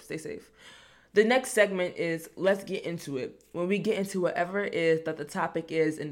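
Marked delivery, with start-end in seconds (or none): 0.0-0.5 much softer, lower trailing repeat; soft stay safe. Acted. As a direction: let it fade off quietly. Quieter repeat of phrase.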